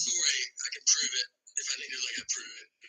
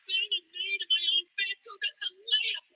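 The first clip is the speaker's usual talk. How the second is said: cocky mock action-star voice, pitched up; Arnie impression; 5 semitones higher than usual. Comic voicing the star bragging to himself, hammed-up accent. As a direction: boast in a put-on muscleman accent. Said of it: "Why wouldn't this guy think".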